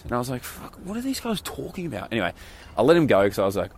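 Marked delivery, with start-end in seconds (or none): 0.4-2.1 high, hushed mock disbelief; exasperated aside. Woken guest to himself about the chatty guys, hushed exasperation. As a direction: grumble it softly to yourself in a high voice. Retold exasperated thought in hostel.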